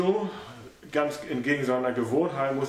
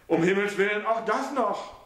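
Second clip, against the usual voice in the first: lightly voiced, alarmed son; son's voice, dismayed; 9 semitones higher than usual. A narrator's reading. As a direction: realize the disaster.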